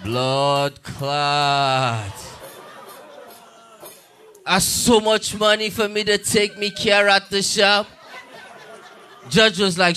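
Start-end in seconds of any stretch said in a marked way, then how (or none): 0.0-2.1 low, drawn-out Jamaican curse; Jamaican cursing. Retold or imitated. Jamaican man in court before the judge, Jamaican cursing. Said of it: "Jamaican men in court start cursing at the judge". 4.5-7.9 mock Jamaican protest; Jamaican accent. Jamaican man protesting to the judge, Jamaican accent. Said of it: "Jamaican men in court start cursing".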